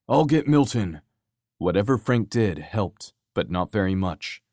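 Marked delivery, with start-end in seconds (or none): 0.1-1.0 raised, higher urgent call; screamed order. A narrator's reading. Tony to Frank before going for the pilot, shouting. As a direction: call it out in a hurry. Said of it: "he screamed at Frank".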